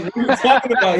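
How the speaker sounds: high, loud, laughing through the question; laughing disbelief. Retold or imitated. Surprised friend already knew.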